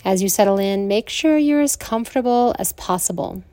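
soothing Yoga Nidra guidance with long pauses; guided meditation. Acted.

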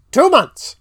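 high-pitched, incredulous emphasis; incredulous repeat. Real, not acted. Host debunking the movie's long breakup, high incredulous voice.

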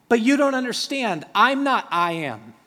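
exasperated Moses. Acted. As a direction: argue the point like he's desperate. Moses arguing with God.

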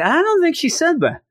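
very high, squeaky protest of disbelief; comic disbelief. Retold or imitated. Nephew doubting his uncle's sweet translation, comic disbelief. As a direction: push back in hammed-up incredulity.

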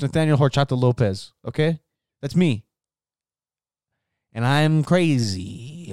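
normal talking voice

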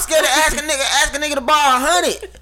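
cracking up in disbelief at the story; laughing. Real, not acted. Incredulous laughing rant.

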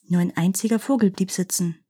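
plain reading voice

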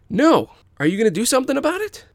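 high, loud, fast bully taunt; angry goading shout. Acted. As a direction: snap it out to provoke.

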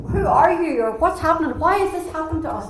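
high, loud, frantic questioning; alarmed sailors. Retold or imitated. Sailors in the storm demand answers of Jonah, frantic. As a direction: voice the panicked crew demanding answers.

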